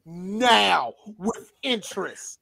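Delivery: slow, deliberate mock ultimatum; slow mock demand. Slow mock demand to the makers of War for a refund. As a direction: drag every word out like a debt collector.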